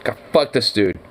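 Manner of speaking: ordinary talk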